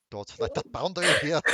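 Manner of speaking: loud posh gentleman laughing; indignant posh gent, laughing through it. Acted. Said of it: "the other posh voice going".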